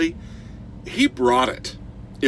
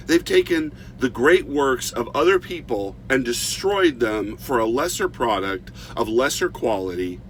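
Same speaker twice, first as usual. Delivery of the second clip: ordinary delivery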